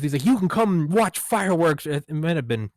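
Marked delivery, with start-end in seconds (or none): 0.2-1.9 high, loud hand-shaking politician; hammed-up eager invitation to strangers; mock glad-hander, pitched way up; LBJ. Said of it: "he's, like, shaking their hands".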